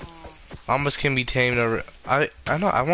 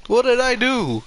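high, innocent what did I do; mock innocent; 12 semitones higher, 3 dB louder than usual. Real, not acted. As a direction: play dumb, squeaky innocent. Feigned innocence after killing friend.

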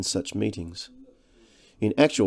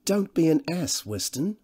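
plain reading voice

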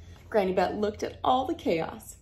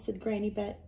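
ordinary delivery, nothing marked

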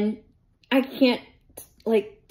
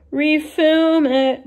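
high, loud, stretched-out words; drawn-out; 7 semitones higher, 8 dB louder, much slower than usual. Real, not acted. Creator faces redoing a video, drawn-out. Grudging about redoing her filming.

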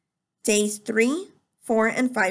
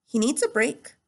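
usual speaking voice

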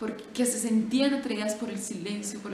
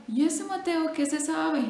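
lightly raised curious friar voice; a hint of an inquisitive friar; friar asking; 7 semitones higher, 3 dB louder than usual. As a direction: ask it up high, curious. Friar asks seller about bird.

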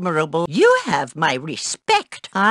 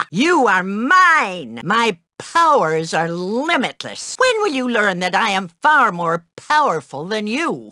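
mock villain voice. Mock villain power fantasy.

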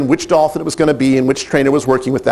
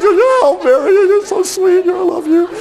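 much higher, half-crying squeal building to a shout; teary-eyed; 14 semitones higher than usual. Retold or imitated. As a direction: imitate a fiancée sobbing with joy. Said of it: "she's all teary-eyed".

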